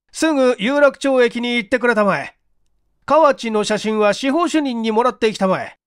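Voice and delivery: brisk chief orders. Acted.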